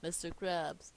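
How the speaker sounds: usual speaking voice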